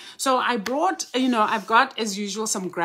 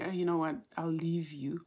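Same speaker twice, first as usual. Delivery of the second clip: put-on soft quote of little girl; 3 semitones lower, 8 dB quieter, faster than usual. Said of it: "she's been sweet to say".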